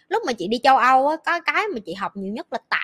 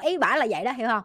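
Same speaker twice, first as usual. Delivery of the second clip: normal talking voice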